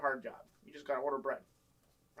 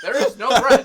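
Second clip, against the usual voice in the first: much higher and much louder; insistent loud retort; 9 semitones higher, 18 dB louder than usual. Retold or imitated. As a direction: hammer it home at the manager. Retold reply, now insistent.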